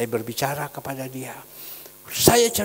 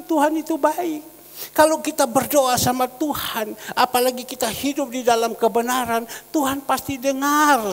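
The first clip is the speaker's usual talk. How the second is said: raised, louder, assured affirmation; emphatic preaching reply; 9 semitones higher, 7 dB louder than usual. Retold or imitated. Pastor answering the crying goods owner, emphatic and assured. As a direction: declare it firmly, pitched up. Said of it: "Ya, saya bilang".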